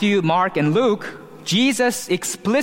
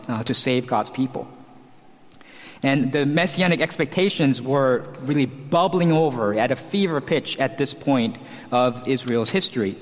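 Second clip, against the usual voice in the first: normal talking voice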